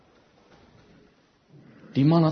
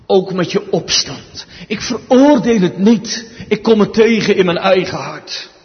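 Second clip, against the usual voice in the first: louder, rising preacher's appeal; preaching at full force; 5 semitones higher, 7 dB louder than usual. Acted. Preacher urging the congregation, preaching at full force. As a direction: press the point home from the pulpit.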